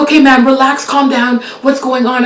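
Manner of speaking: a hint of a brisk operator calming her; calming dispatcher. Dispatcher calming a frantic caller, mock brisk.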